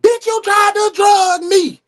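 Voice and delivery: slow, high, loud mock yell; a hammed-up outraged shout; Toya yelling. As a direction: bellow it like a furious wife. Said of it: "Toya up saying".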